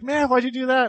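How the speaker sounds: high-pitched retold complaint; imitated hurt protest. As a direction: imitate a friend asking for an explanation. Friend protests after the fight.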